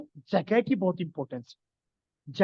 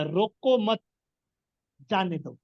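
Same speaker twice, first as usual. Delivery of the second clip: long pause between मत and जाने; pause after मत; slower than usual. Shown as a demonstration. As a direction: break after मत, drop on जाने दो. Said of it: "बीच में stop".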